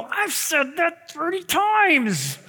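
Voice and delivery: high, slow, exasperated whine; a drawn-out put-upon complaint; mock exasperation. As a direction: let your frustration leak out, hammed up. Pastor's inner frustration.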